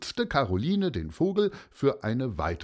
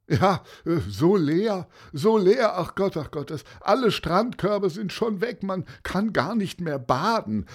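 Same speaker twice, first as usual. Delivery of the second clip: raised, agitated moaning about it all; lamenting pupil; 8 semitones higher, 5 dB louder than usual. A narrator's reading. Pupil complaining about the empty beach, lamenting. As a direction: wail it in a higher, louder voice.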